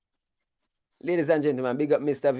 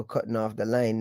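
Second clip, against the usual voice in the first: normal talking voice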